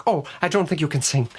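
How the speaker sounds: high, put-on Irish accent; Louis. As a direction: let them down in a bad Irish lilt. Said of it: "Louis would be like".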